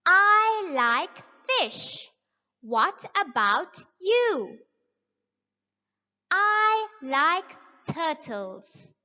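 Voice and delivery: slow, clear, every word spelled out; slow model reading. Shown as a demonstration. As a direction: read it slowly for young learners. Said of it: "Let's practice the question and answer".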